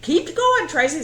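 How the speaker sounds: high, loud, pushy teacher urging her on; insistent teacher. Retold or imitated. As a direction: push her on like a bossy teacher. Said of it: "and she".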